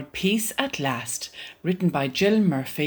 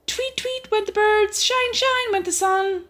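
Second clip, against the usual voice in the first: very high sing-song; high chirpy voice. Acted. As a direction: chirp it brightly like birds. Birds and sun rising.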